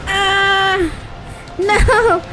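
high, drawn-out wail of dismay; dismayed cry. Real, not acted. Player losing mid-fight, high dismayed cry.

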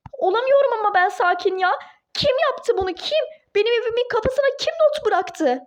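high, rising furious demands; explode, demanding who did this; furious outburst. Acted. Duru, refusing to calm down, shouting at Ali, high furious.

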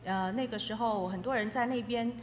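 normal talking voice

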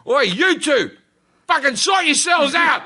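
a neighbour yelling at a fight to stop; shouting out the window. Retold or imitated. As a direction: bark it down the alley at two brawlers. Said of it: "I stick me head out the window, and I go".

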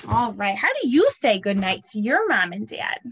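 ordinary talk, nothing marked

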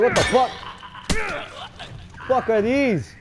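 high, loud shout of alarm; loud startled yell; panicked retold yell. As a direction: shout it like you just got spooked. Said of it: "I was like".

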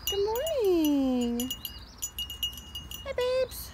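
long crooning good morning to the ram; drawn-out pet talk. Real, not acted. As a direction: stretch it out cooing to the animals. Greeting animals, said twice.

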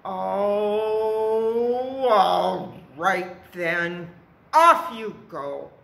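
loud, drawn-out troll giving in; grudging troll. A narrator's reading. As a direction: let the goat pass, loudly and slowly.

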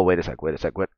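normal talking voice

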